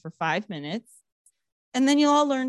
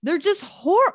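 usual speaking voice

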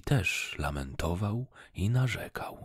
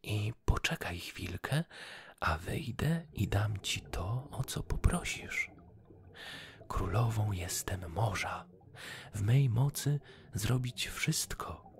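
goldfish voice; 5 semitones higher than usual. Acted. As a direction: remind him gently as the fish. Goldfish speaks as sea queen.

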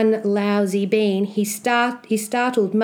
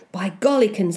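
usual speaking voice

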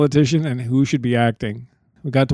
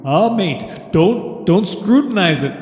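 put-on Liverpool accent, pitched up; higher-pitched mock Scouse, Liverpool style; 5 semitones higher than usual. Podcaster playing a Beatle to his co-host, mock Liverpool accent. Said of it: "That's my Liverpool accent".

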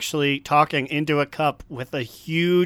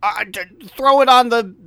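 panicked developers; 8 semitones higher, 4 dB louder than usual. Retold or imitated. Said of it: "so they're like".

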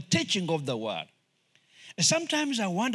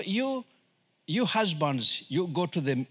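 ordinary talk

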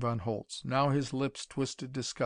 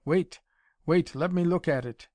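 urgent plea; 4 semitones higher, 4 dB louder than usual. A narrator's reading. Von Holtz desperate to see.

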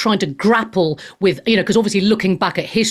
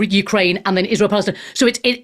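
ordinary delivery

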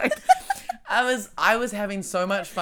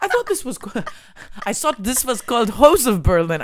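weak attempt at a German accent; a failed German accent; 5 semitones higher, 8 dB louder than usual. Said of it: "I can never do the German accent".

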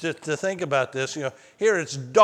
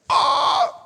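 sharp unvoiced gasp of fright; sudden scared shout; startled yelp; 9 semitones higher, 6 dB louder than usual. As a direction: yelp like you just touched a snake. Startled by snake, retold.

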